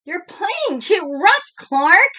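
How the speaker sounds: put-on high, loud kids' complaint; crying out kids. A narrator's reading. The other kids crying out at Clark, high and loud. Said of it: "cried the other kids".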